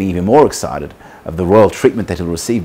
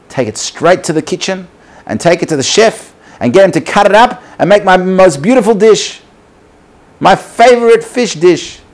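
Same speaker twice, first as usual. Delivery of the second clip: high, mounting, louder command; eager royal order; 9 semitones higher, 4 dB louder than usual. Acted. King sending the fish to his chef, eager command. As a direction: build relish toward a loud, high finish.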